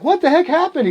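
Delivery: very high, loud re-enacted disbelief; incredulous squawk at a friend's state; shocked reaction. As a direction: blurt it in high-pitched alarm. Startled reaction to friend's lost voice.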